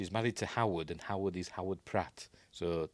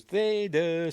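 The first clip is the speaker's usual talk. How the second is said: calling out; 10 semitones higher, 6 dB louder than usual. Retold or imitated. Calling the alligator by name.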